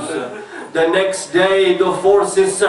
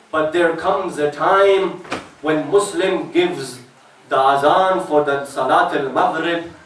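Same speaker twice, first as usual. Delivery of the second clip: mournful majlis narration; 2 semitones lower than usual. Acted. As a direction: tell the lament, letting it drop and fade.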